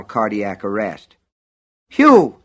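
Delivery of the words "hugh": high, loud startled exclamation; gasped cry. A narrator's reading. Old driver to the man who stopped him, gasping. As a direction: gasp the name out in shock. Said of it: "he gasped".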